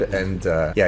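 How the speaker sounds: ordinary talk, nothing marked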